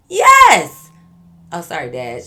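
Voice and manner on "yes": real full-throated scream of "Yes", very high and loud. Real, not acted. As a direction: scream "Yes" at the top of your voice. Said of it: "I screamed and it woke him up".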